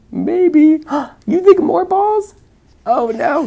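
high and loud with gasps; excited. Acted. Mother reading the ball chaos excitedly to her son. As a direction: gasp it all out high.

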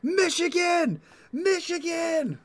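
high, drawn-out yell into a walkie-talkie. Pretend. Player's character calling into his walkie-talkie, yelling. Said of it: "he's just yelling into this thing".

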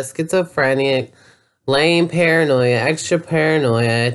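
ordinary talk

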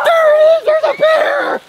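high, loud yell of alarm; dad shouting a warning in pretend play. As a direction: cry out as the bear appears. Dad spots the pretend bear.

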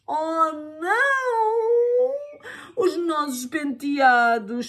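high, slow, whiny wail; mock whimpering lament. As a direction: whimper over the ruined hair. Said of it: "choramingou a mãe da Eva".

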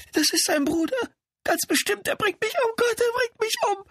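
very high, panicked gasping voice; panic, squealed high in mortal fear; terrified. A narrator's reading. Woman hears the dead man's brother outside, high-pitched terror. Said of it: "schnappte hörbar nach Luft und kauerte sich".

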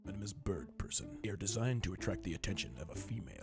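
very low, soft, monotone alien; Bird Person voice. Pretend.

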